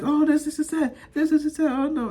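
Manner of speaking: high-pitched mock chatter; imitating the wife. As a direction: rattle off vague phone talk up high. Mimics wife's talk on phone.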